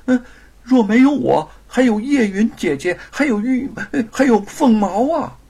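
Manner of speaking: high, loud pleading; frightened wife. Acted. Wife begs husband to put down sword, frightened pleading. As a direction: talk him down in panic.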